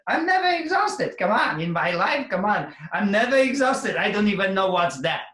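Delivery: high, loud insistent declaration; emphatic boast. Real, not acted. Coach to audience, boasting loudly he never tires. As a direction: proclaim it with full conviction.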